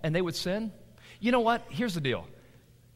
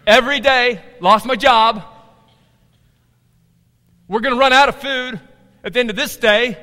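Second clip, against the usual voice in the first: loud, raised dinner-table outburst; loud exasperation; 5 semitones higher, 10 dB louder than usual. Acted. Husband telling family he lost his job, loud exasperation. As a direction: play up the daily bad news, loudly.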